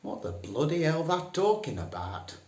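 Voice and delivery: broad Northern "What the bloody hell". Shown as a demonstration. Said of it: "best to use the phrase".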